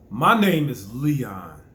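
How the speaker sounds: slow, strong My name is Leon; with power. Shown as a demonstration. Showing the boys how to say a name, slow and strong. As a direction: say your name like you own it. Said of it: "I say my name with power".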